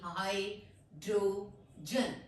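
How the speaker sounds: Hydrogen very slow, in three separate parts; split into syllables. Shown as a demonstration. Teacher dividing a long word, syllable by syllable. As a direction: break it as हाइ, draw, जन with gaps. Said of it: "syllables में divide करूंगी".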